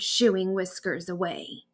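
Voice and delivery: ordinary delivery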